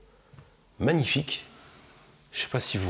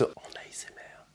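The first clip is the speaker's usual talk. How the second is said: hushed ASMR whisper; 11 dB quieter than usual. Real, not acted. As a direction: whisper close to the mic. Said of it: "on écoute".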